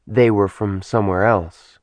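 usual speaking voice

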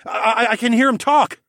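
high, loud, stammering astonishment; amazed gasp. A narrator's reading. Telling the Oryx woman of a discovery, gasping in amazement. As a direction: gasp it out, stumbling on the first word. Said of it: "I gasped to the Oryx woman".

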